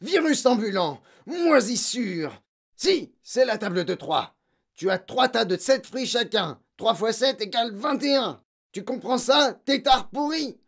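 high, loud, hectoring tirade; fierce bullying rant; raging headmistress. A narrator's reading. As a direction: hound the pupil with insults and questions. Headmistress rages at the pupil.

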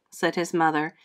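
usual speaking voice

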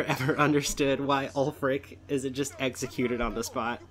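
normal talking voice

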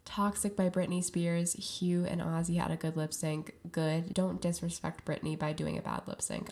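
usual speaking voice

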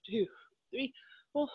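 ordinary talk

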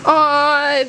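normal talking voice

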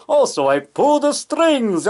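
high, slow theatrical delivery; mock-grand, drawn-out impersonation; Bela Lugosi. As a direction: draw it out like a hammy old horror star. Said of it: "And Bela's looking".

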